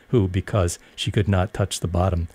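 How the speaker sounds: ordinary delivery, nothing marked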